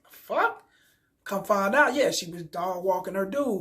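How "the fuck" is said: high rising "The fuck?"; baffled at what you're seeing; disbelieving the fuck. Retold or imitated. Finding the name Amber on another page, high baffled exclamation. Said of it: "I'm like".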